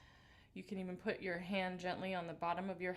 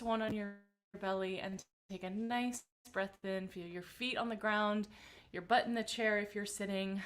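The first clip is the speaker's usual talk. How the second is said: calm grounding exercise. Acted. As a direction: guide the breath gently. Leads breathing warm-up.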